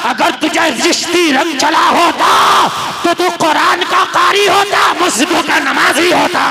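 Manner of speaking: high, loud preaching climax; full-force sermon shout. Real, not acted. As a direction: thunder it at the crowd.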